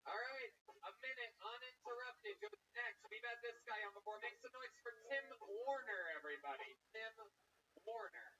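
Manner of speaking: booming showman intro; emcee announcement. Acted. Host hypes the crowd for the comic, announcer style. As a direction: sell the act to the crowd.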